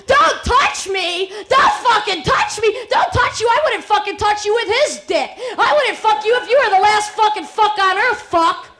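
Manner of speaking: loud furious shout; screaming back. Acted. Woman refuses the man, loud furious yelling. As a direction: hurl it back at him full force.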